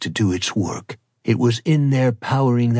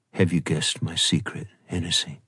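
low, softened murmur of a question; 7 semitones lower, 5 dB quieter than usual. A narrator's reading. Bride asks Hennessy about his secret, low voice. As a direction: ask it low and soft, keeping it down. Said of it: "said in a low voice".

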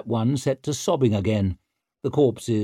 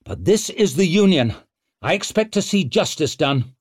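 stern demand; 8 semitones higher, 4 dB louder than usual. A narrator's reading. Brock demanding justice.

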